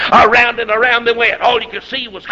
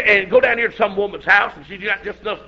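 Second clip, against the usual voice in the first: normal talking voice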